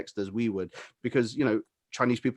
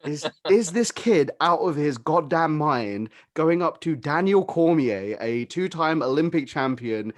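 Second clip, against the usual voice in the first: louder and higher, slow incredulous outrage; outraged rant; 6 semitones higher, 5 dB louder than usual. Real, not acted. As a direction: rant slowly at the absurdity, raising the voice. Outraged at Jake Paul.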